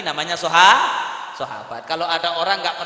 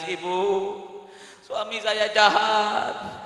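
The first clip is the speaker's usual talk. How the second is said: mock tearful wailing; 9 semitones higher, 9 dB louder than usual. Unhappy bride phoning her mother about her husband, mock wailing. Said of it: "telepon ibunya nangis".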